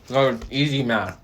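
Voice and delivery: talking while crunching food; chewing between words; crunching chips. Real, not acted. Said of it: "I'm sorry if I'm crunching on it".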